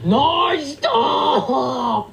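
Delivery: slow, drawn-out exasperated wail; wailing protest. Real, not acted. Streamer protesting at viewers threatening galaxy gifts, wailing. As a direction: drag the words out like a tantrum.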